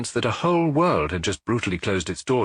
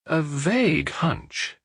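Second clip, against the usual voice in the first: slow, hesitant apology; apologetic; 7 semitones higher, much slower than usual. A narrator's reading. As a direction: offer it almost as an excuse. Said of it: "Maigret said apologetically".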